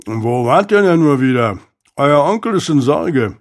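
lightly voiced servant talking through food; a touch of chewing, rising then falling; remarking while chewing. A narrator's reading. Servant to Isaac at the gate with pie in hand, remarking while chewing. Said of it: "und bemerkte kauend".

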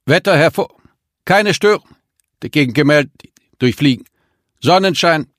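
high, loud voice breaking up over radio static; choppy transmission, words cutting out. A narrator's reading. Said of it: "Es knisterte im Lautsprecher".

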